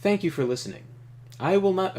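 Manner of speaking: a lightly voiced calm, powerful leader; Trakov voice. Reading Trakov's lines to the player, calm and commanding. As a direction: play him as a composed ruler opening talks.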